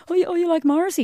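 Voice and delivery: put-on eager girlish gush. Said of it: "morph into the kind of person".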